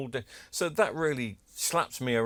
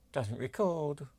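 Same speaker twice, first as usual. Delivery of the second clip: higher put-on eBay seller accent; mock seller accent; 4 semitones higher, slower than usual. Collector predicting the machine's excuse, mock seller accent. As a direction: mimic the seller's accent as a running joke. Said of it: "they always say that in that particular accent".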